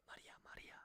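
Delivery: whispered name, twice. Real, not acted. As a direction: hush it like a secret. Calling a chatter secretly.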